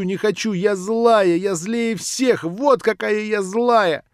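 high, loud, slowing at the end; tearful rage. Acted. Nelly screams about begging, tearful and defiant. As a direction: hurl it out sobbing.

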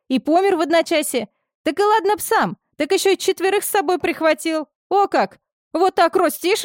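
put-on high, fast gossiping old woman; gossiping village woman. A narrator's reading. Orderly gossips about deaths.

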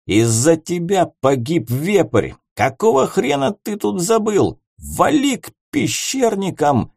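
put-on high drunken accusing rant; belligerent drunk. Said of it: "пьяно изрёк Воронцов".

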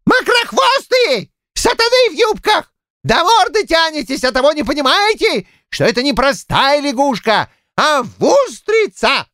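put-on high-pitched angry old man's tirade; exasperated shouting. A narrator's reading. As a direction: scold them at a very high pitch. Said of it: "разозлённый бабами щукарь не крикнул". (разозлённо кричит, высоким голосом)

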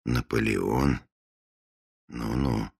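quiet doubtful inner voice; skeptical thought. Acted. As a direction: think it with quiet irony. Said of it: "подумал Фандорин".

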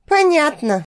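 plain reading voice

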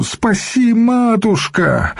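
very high, drawn-out plea; desperate wail. A narrator's reading. Young monk begs the matushka to save him, wailing. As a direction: wail for rescue, high and long. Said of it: "завопил он сходу".

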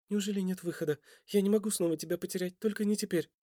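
soft, high, distressed woman; tearful pleading. A narrator's reading. Лера fears losing Костя again, softly tearful. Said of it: "Её губы дрожали, слёзы обильно".

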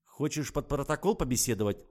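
angered threat. A narrator's reading. Investigator threatens driver with a formal statement, angry. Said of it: "обозлилась я".

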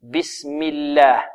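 slow, soft "بسم الله" with a thin ل; light lam. Shown as a demonstration. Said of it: "مكسورا ترقق". (ترقيق لام «بسم الله»)

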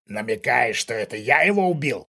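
mocking question with menace underneath; threatening smirk. A narrator's reading. Intruder answers father's insinuation, smirking threat. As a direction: dare him to say it outright. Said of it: "усмехнулся Кшиштоф".

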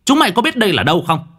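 furious yelling. A narrator's reading. Medium screaming at the ghosts at his gate, furious yell. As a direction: slam the table and bellow at the spirits. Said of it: "Cậu nghiến răng đập bàn đánh thình một cái".

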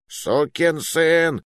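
медленный громкий рёв; проревите, растягивая. A narrator's reading. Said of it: "ревёт толстяк". (ревёт толстяк в бою)

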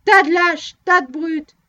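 loud furious shout at the crowd; young man yelling at a cowardly crowd, loud. A narrator's reading. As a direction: hurl it at them, loud. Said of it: "le grand jeune homme cria".

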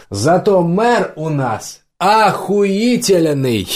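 slow, drawn-out, high-pitched boast; proclaiming bar patron. A narrator's reading. A tavern patron stands up to praise the mayor, proclaiming. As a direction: proclaim it high, stretching the words out. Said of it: "один из посетителей поднялся и заявил".

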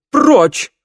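high, loud furious shout; booming warrior king. A narrator's reading. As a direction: bellow him away. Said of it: "вскрикнул". (громовой крик короля)

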